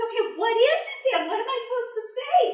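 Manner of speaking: high, fast, eager asking; eager question. Acted. Bush deer hurrying to ask Anansi what to say, high and quick. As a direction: rush the question out.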